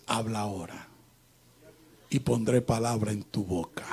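low, hushed divine reply; God's voice. Acted. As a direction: speak low and calm, as God answering a prayer. Voices God answering his prayer.

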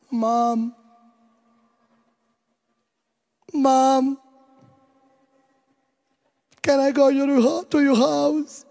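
put-on a sheepish boy testing the line; son calling in English. Son phoning home after two days, hesitant and sheepish. Son calls back, timid.

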